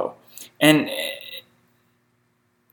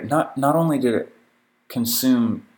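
ordinary talk, nothing marked

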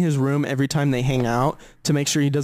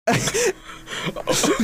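airy high-pitched giggle; high breathless laugh. Real, not acted. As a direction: let out a silent wheezing laugh. Laughs at retold story.

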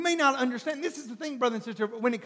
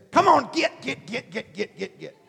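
hammed-up impatient urging; mock pushy hurrying. Preacher mimics someone rushing you, pushy.

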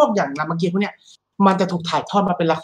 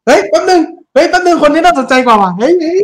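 high, louder, laughing hey wait; excited laughing interrupt; 8 semitones higher, 5 dB louder than usual. Real, not acted. Host interrupting co-host for a live surprise, excited laugh. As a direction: cut in excitedly through laughter.

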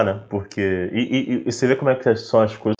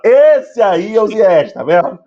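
slow, loud and much higher; explosive goal commentary; 7 semitones higher, 6 dB louder than usual. Acted. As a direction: shout it like a goal call. Repeats brag, louder, to hosts.

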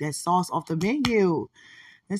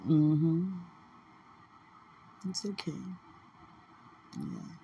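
usual speaking voice

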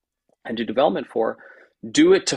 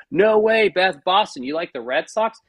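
high, loud burst of delighted surprise; mock-excited fan spotting a shared team; excited sports fan; 7 semitones higher, 4 dB louder than usual. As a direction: pitch jumps up, then settles slightly. Acts out sports fan's reaction.

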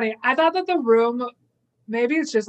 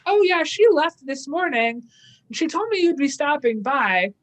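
higher-pitched, lightly voiced housekeeper; imitating a housekeeper; 6 semitones higher than usual. Housekeeper tells detective she left, lightly imitated in higher voice. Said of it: "her housekeeper is like".

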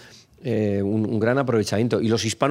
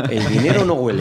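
louder motto, lightly announced; mock slogan; 4 dB louder than usual. Speaker pitches the phrase as a tax-amnesty slogan, lightly declaimed. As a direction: proclaim it like a motto. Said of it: "proposed motto for tax amnesties".

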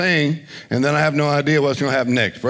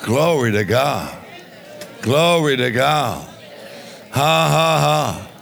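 slow praise refrain; slower than usual. Real, not acted.

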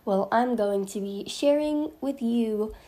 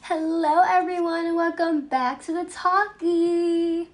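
excited host intro; 6 semitones higher, 4 dB louder than usual. Acted. Podcaster greeting listeners with big excitement. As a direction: stretch the welcome out, thrilled. Intro take, slower and louder.

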